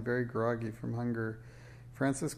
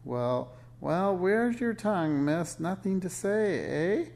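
high, loud witch's taunt; screechy needling with a sharp questioning end; 7 semitones higher, 4 dB louder, slower than usual. A narrator's reading. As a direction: taunt the child like a wicked witch. Witch taunts the silent child.

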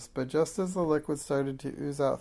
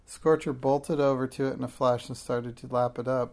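ordinary delivery

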